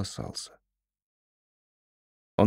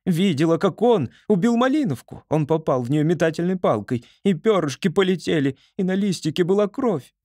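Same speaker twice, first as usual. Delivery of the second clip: agitated squirrel voice; 7 semitones higher than usual. A narrator's reading. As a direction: blurt it out, upset and high. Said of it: "закричала Поня".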